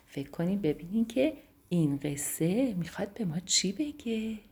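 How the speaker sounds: soft, hushed goodnight; bedtime wind-down. A narrator's reading. Storyteller saying goodnight to sleepy children, softly hushed. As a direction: lull the children toward sleep.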